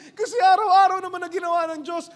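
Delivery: high, loud wailing then fading; sobbing confession. Acted. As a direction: widow blurting out the punchline. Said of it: "Umiyak lalo".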